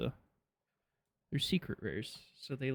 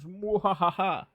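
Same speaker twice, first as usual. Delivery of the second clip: evil laugh; 6 semitones higher, 5 dB louder, slower than usual. Pretend. Cackle after villain line.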